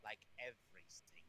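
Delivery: put-on German accent. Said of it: "I heard him start to do the accent".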